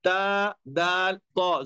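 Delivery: ता दाल तो, each sound drawn out slowly; slow, careful ता दाल तो from the tongue tip; letter sounds demo. Shown as a demonstration. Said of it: "मखरज इसका बनेगा". (ता, दाल, तो धीरे उच्चारण)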